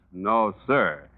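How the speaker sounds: usual speaking voice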